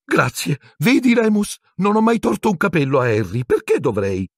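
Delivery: very high, eager squeak. A narrator's reading. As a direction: jump at the chance in triumph. Pettigrew seizes Hermione's point. (Pettigrew esclama con voce acutissima)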